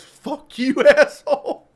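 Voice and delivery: reading the note through laughter; bursting out laughing on the insult. Real, not acted. Recalling the note, laughing hard.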